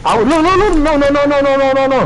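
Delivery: high, loud, rapid "no, no"; excited protest. Real, not acted. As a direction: jump in, cutting them off.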